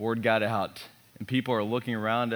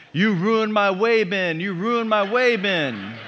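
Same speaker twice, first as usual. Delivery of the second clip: put-on high, slow, wailing little boy voice; crying toddler; 8 semitones higher, 7 dB louder, slower than usual. Dad imitating his crying son on the plane, high slow wail. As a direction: wail it like a three-year-old whose toy is ruined. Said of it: "he's crying".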